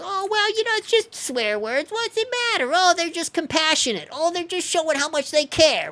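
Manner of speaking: mock them in a squeaky, dismissive voice; mocking whiny voice. Mocking people who excuse swearing.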